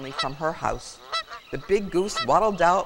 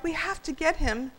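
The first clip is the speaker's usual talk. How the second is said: high, urgent plea; high-pitched and desperate; distressed cry; 11 semitones higher than usual. A narrator's reading. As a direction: plead for help in a high voice. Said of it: "she cried".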